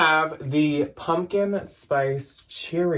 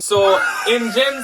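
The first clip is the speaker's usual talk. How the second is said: slower, higher, louder put-on Canadian accent; mock Canadian accent; 9 semitones higher, 5 dB louder, slower than usual. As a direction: drag it out in a hammed-up Canadian accent. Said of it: "How do I say that in a Canadian accent?".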